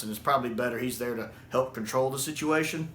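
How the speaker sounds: ordinary talk, nothing marked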